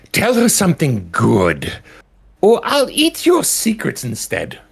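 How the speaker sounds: a high imp scribe blurting to its mistress. Acted. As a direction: squeak it out like a tiny demon tattling. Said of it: "the imp that's on her shoulder, um, looks up".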